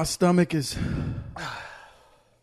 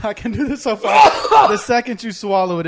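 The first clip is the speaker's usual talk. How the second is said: laughing; 5 semitones higher, 10 dB louder than usual. Real, not acted. Laughing through spicy challenge burn.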